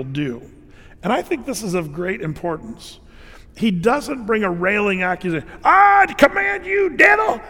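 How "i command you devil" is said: very high, loud, slow dramatic command; hammed-up shouted exorcism; mock televangelist shout. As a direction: parody a TV exorcist yelling at the devil. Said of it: "He didn't do that. That was just T B N".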